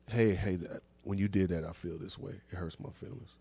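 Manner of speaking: hushed imitation of someone admitting they're hurt; soft hurt confession. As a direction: say it quietly, like opening up about being hurt. Hypothetical man voicing hurt feelings.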